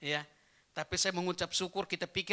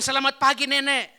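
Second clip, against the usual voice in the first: high-pitched shouted greeting; loud greeting; 9 semitones higher, 16 dB louder than usual. Retold or imitated. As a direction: call out high and loud to granny. Loudly greeting a very old woman.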